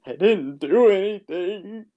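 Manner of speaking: high, slow mock whimper; imitated tearful plea; Josh. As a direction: protest innocence as if about to cry. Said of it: "You're like Josh from Merry Christmas".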